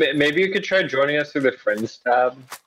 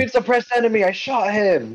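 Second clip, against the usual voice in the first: pitched-up excited callout; excited high voice; 10 semitones higher than usual. Real, not acted. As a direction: blurt it out, thrilled. Excited high game talk.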